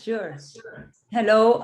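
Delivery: usual speaking voice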